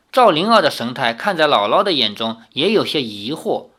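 usual speaking voice

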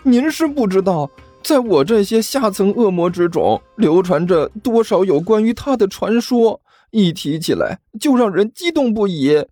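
high, stammering thrilled minion; trembling excitement. Acted. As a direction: babble with thrilled awe. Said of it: "嘴唇颤抖，激动得几乎不能自己".

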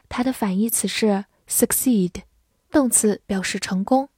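normal talking voice

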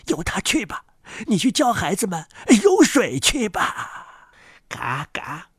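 put-on hushed, creaky old duck voice; knowing old duck. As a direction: advise like an old know-it-all duck. Old duck advises in story.

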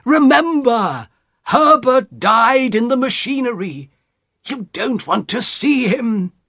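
anguished high-pitched husband; desperate plea. A narrator's reading. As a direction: beg her to remember, drawing it out. Husband pleading with running wife.

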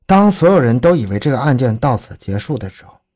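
usual speaking voice

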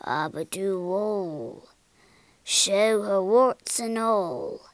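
very slow, intoned spell; wizard's incantation. A narrator's reading. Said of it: "he cried".

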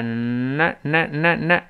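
呢呢呢呢 drawn out slowly, tongue sliding back; slow sound drill. Shown as a demonstration. Teacher slowly demonstrating the n sound. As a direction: stretch each n very slowly. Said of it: "你看是这么一个声音".

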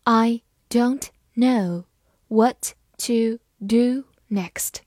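slow, every word separated; deliberate slow dictation pace; slow read-along. Shown as a demonstration. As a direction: read it slowly for students to follow. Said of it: "跟着我慢读一遍".

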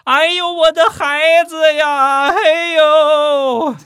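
hammed-up, very high drawn-out wail; loud wail. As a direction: shriek it long and loud, faking a lost baby. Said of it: "捂着肚子大声地喊道".

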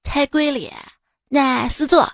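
put-on comic street vendor in Xi'an accent; Xi'an-accented Mandarin hawker. Vendor selling to foreigners near the Terracotta Army, Xi'an accent. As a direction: hawk goods in Xi'an dialect mixed with English. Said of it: "就是西安普通话夹杂着".

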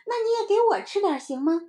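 put-on high, fast, eager kid; pleading little child. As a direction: beg a share in a child's voice. Child begs playmate for some. (小孩高声急切央求)

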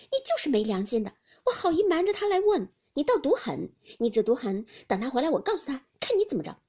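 Ping'er the maid, mock-indignant and threatening. Maid scolding Jia Lian for ingratitude, loud playful indignation.

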